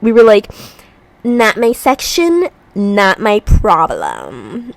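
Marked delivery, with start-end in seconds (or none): 1.2-4.7 very slow, dragged meme quote; meme recital. Retold or imitated. Exhausted friends reciting a meme, slow drawl. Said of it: "We were reciting memes".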